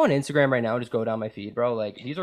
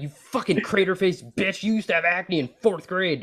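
mock dirty talk insult; 7 semitones higher, 3 dB louder than usual. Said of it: "I'll be like".

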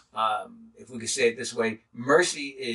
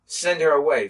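raised, dismissive send-off; mock impatient demand; 5 semitones higher, 7 dB louder than usual. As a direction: push her away, irritated. Disciples' demand voiced.

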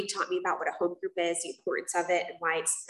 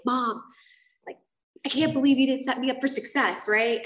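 freaking out; 6 semitones higher, 4 dB louder than usual. Retold or imitated. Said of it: "I'm, like, freaking out".

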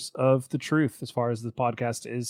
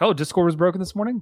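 retold surprise; 7 semitones higher, 3 dB louder, faster than usual. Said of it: "and I was like".

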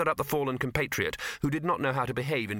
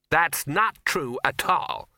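higher, louder, drawn-out indignant denial; snapped; 5 semitones higher, 5 dB louder, much slower than usual. A narrator's reading. Ginger denying the trolls' innuendo, snapped. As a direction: deny it sharply, stretching the words. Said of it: "snapped Ginger".

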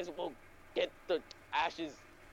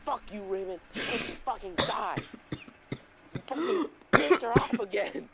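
high, building to a loud mock outburst; hammed-up rage, stretched out and rising; acted angry classmates; 6 semitones higher, 5 dB louder, slower than usual. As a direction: heckle him as the fed-up class, louder at the end. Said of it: "everybody was like".

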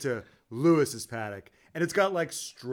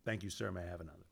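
much softer, lower mock submissive; meek mock submission; 8 semitones lower, 14 dB quieter, much faster than usual. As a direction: murmur it like a grovelling servant. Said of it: "they're basically like".

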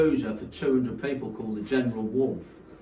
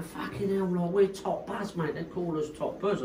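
lightly imitated Cockney geezer, pitched up; higher mock voice of a little Cockney DJ; Jason; 6 semitones higher, 4 dB quieter than usual. Cockney DJ introduces his crew to a promoter, lightly imitated, high. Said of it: "this little Cockney geezer".

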